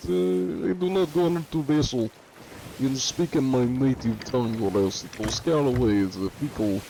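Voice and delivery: mock-Russian accent, playing the big sweaty foreigner. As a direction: put on a hammy Russian accent. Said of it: "A Russian accent".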